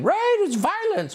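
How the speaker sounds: very high, drawn-out sing-song; mock sing-song pundit. Host mimics a woman on cable news, mock sing-song. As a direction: imitate her in a slow, high mocking lilt. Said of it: "talking in a sing-songy voice".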